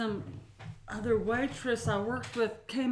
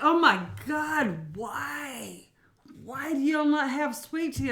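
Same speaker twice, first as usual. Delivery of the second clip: drawn-out, higher, hammed-up lament; mock dismay; 4 semitones higher, 4 dB louder, slower than usual. Waitress imitates customers learning there's no sweet tea, mock dismay. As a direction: imitate customers pleading to know why.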